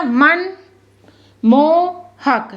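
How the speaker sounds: मन then मोहक, drawn out slowly; split into parts. Shown as a demonstration. Teacher repeating the word in slow pieces. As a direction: say मन, pause, then stretch मोहक. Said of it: "पढ़ा करो दो दो एक एक वर्ड को लेकर".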